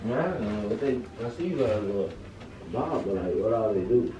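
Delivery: muffled talk from under the bed; far-away voice. Real, not acted. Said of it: "You sound like you far away".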